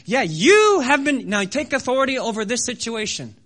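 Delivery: loud, drawn-out command, easing as it goes; voice of the Lord. Retold or imitated. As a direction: answer the doubter with firm authority. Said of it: "I hear the Lord say".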